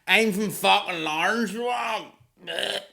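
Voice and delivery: put-on high shouted drunk woman; drunk heckler. Drunk heckler bragging about her town, high and shouting. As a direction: yell it like a sloppy drunk heckler. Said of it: "she's like".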